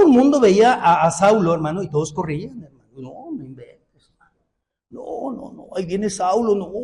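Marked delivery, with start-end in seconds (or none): 2.9-6.7 mock fright. People fleeing Saulo in fear.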